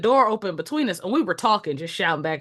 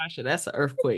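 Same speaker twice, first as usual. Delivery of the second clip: soft, low and matter-of-fact; low deadpan reply; 9 semitones lower, 9 dB quieter than usual. Retold or imitated. Speaker answering her ranting coworker about the quake, quiet deadpan. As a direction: drop right down to answer the rant.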